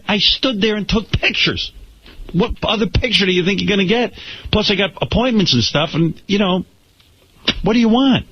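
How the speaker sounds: high, exasperated pleading; mock exasperation at the photographer; fed up. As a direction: vent your frustration at him.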